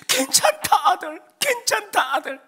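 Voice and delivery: high, loud imitated mother; mother consoling. Mother patting her heartbroken son, emphatic consoling. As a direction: comfort with an emphatic, high-pitched voice.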